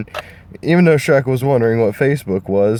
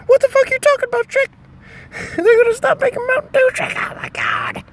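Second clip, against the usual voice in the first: Donkey voice; faster than usual. Acted. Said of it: "Donkey's like".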